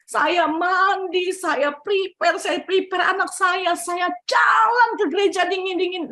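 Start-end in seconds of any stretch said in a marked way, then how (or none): none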